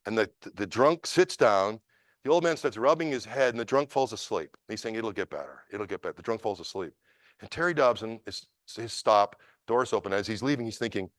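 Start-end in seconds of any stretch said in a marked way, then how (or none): 4.9-6.1 much softer, gentle reassurance; hushed tender consolation; soothing murmur. Retold or imitated. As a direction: comfort him as he drifts off. Rubbing the sleeping drunk's head.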